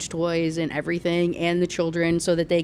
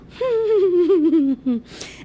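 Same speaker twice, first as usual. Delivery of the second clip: big high burst of laughter; laughing; 11 semitones higher, 6 dB louder than usual. Real, not acted. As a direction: laugh out loud at it. Laughs at the absurd scene.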